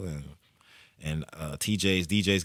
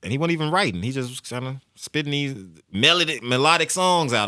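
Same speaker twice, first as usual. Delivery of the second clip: usual speaking voice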